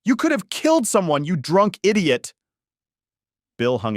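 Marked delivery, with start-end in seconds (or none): 0.0-2.3 high furious shout, slowing at end; angry shout. Acted. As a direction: yell it at the drunk driver. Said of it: "shouted angrily".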